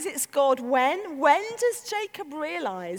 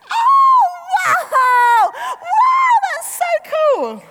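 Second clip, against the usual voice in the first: very high, loud squealed excitement; mock gushing awe; 10 dB louder, much slower than usual. Shown as a demonstration. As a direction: overdo it like a squealing fan. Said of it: "It doesn't feel like this".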